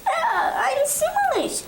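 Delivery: very high, drawn-out dying squeak; a tiny high wail as it gets smushed; squashed apricot. Acted. Crushed apricot squeaks its last words, slowly.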